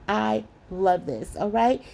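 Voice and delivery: usual speaking voice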